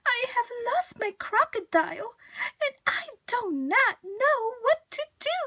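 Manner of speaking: upset high lament; tearful plea. Acted. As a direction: beg for help with a sob. Mrs Prim to man.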